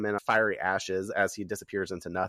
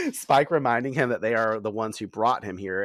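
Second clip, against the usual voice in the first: ordinary talk, nothing marked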